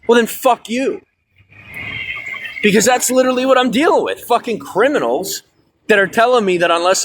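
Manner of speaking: rising, heated fuck you; real frustration boiling over at the system; angry outburst. Real, not acted. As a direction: blow up, pitch climbing high. Ranting at government over documents.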